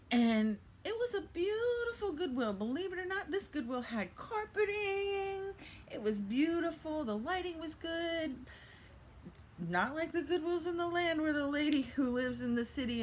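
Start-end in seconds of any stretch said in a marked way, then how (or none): 0.1-11.3 gushing delight. Real, not acted. Thrifter raving to viewers about the lovely store, delighted and high. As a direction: sing the praises like it's a palace.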